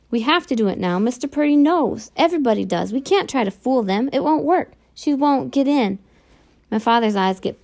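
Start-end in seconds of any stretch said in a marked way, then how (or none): 0.1-6.0 urgent plea. A narrator's reading. Son pleads urgently with father.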